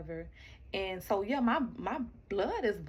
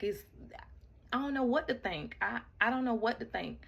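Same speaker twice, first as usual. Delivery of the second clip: ordinary talk, nothing marked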